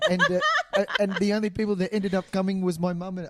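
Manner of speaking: mock New Zealand bouncer voice, laughing; a laughing New Zealand accent, breaking up; Korg impression. Friend quoting Korg to the podcast hosts, laughing New Zealand accent. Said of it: "oh, that's a terrible accent".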